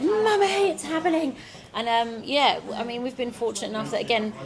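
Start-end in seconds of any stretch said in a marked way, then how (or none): 0.0-1.4 put-on high, thrilled child voice; excited little girl. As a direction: squeal it like a delighted toddler. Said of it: "she's like".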